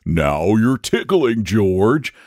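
coy. A narrator's reading. Dragon being poked by Saint George, coyly. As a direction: play bashful, stretching the words. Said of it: "said the dragon coyly".

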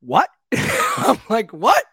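high, startled questions; incredulous, voice jumping up; retold disbelief. Retells stunned reaction to manager.

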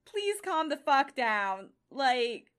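loud, slow fed-up shouting; exasperated yelling. Real, not acted. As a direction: yell at her to calm down. Exasperated at the heroine.

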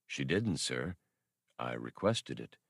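low, polite, even droid voice. Acted. Old labor droid politely answering Han, low and even.

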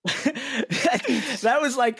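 host admits the plan is insane, laughing; laughing admission. Real, not acted. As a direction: own the craziness while cracking up.

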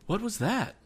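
jolted by the jar; startled cry. Acted. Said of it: "cried the captain".

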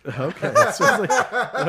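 slow words through laughter; laughing out the words. Real, not acted. Host cracks up at the snort.

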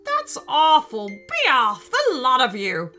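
loud, high, angry dismissal; shouting queen. Acted. Queen throws out witch and bards, loud anger. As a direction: banish them all with a shout. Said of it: "shouted the queen".

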